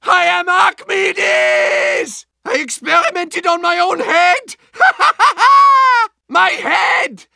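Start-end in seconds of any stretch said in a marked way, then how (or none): 4.8-6.1 high, loud, maniacal cackle; maniacal laugh. Acted. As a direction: cackle like a mad scientist. Bird head madness.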